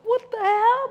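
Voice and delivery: sudden high alarmed what-the-hell; startled outburst. Retold or imitated. Meditator startled by unseen hands, blurting in alarm. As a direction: jolt up in shock.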